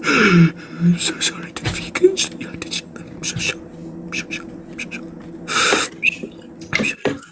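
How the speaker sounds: slow, ashamed whisper. Real, not acted. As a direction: murmur the apology. Apologizing to vegans, hushed.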